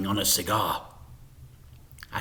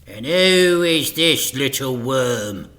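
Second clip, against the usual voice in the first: louder contemptuous demand; 2 semitones higher, 8 dB louder than usual. A narrator's reading. Mister Big to Frank, contemptuous demand. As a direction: sneer down at the boy. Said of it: "demanded Big".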